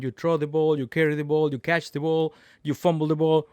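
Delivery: ordinary talk, nothing marked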